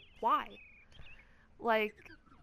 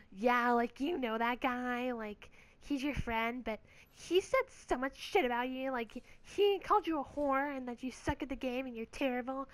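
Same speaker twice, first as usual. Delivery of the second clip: slightly raised, put-on tattling voice; imitated gossiping guy; 3 semitones higher, 3 dB louder than usual. Guy tells her what her friend said, imitated gossipy voice. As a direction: play someone eagerly passing on insults.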